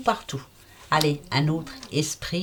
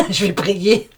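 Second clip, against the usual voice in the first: laughing; 5 dB louder, slower than usual. Real, not acted. Pretends to pray, laughing.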